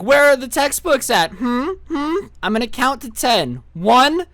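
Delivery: high-pitched imitation of a demanding teacher; hammed-up high teacher voice; mock stern countdown. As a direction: count at them like a fed-up substitute.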